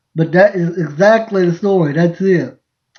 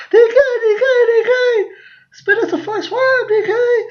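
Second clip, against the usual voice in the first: much higher, squealing fan voices through laughter; screaming girls. Retold or imitated. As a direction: squeal like excited fans at a car window. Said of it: "they started screaming at me".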